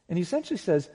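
ordinary talk

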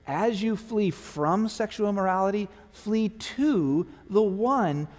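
high, loud impassioned preaching; full-force preaching. Acted. Pastor urging his adrift church, impassioned preaching. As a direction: plead with the church to run to Jesus.

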